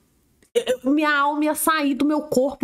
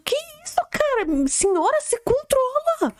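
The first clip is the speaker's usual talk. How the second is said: mock shock; 11 semitones higher, slower than usual. Shocked reaction to threatening audio.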